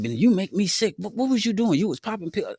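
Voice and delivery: high-pitched mock telling-off; imitating cussing girlfriend. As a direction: play her scolding him in the background. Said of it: "she's still cussing and stuff".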